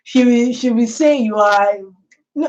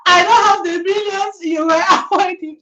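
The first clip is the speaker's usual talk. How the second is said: laughing mock confession; 9 semitones higher than usual. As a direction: blurt it out while bursting into laughter. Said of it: "you say".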